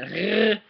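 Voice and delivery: "r" as a long uvular trill; German R sound. Shown as a demonstration. Teacher flagging the tricky sound, trilled throat "r". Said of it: "that R sound".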